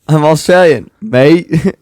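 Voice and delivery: loud mock Australian accent; hammed-up Aussie imitation; Kevin. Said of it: "like Kevin in The Office".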